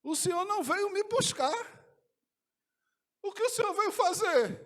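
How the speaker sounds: high, loud, stammering tearful questions; weeping man asking Jesus why he came; tearful pleading. Retold or imitated. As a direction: beg for an answer through tears. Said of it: "Eu já falei chorando".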